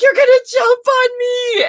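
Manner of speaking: freaking-out shriek, very high and loud; comic hysterical wail; Leo Bloom. Acted. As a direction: shriek it in mock terror. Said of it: "he's just freaking out".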